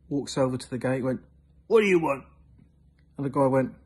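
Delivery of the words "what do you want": higher, louder challenge; unhappy farmer. Acted. Farmer confronting stranger at the gate, displeased challenge. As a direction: demand it from a trespasser, mildly acted. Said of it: "not very happy".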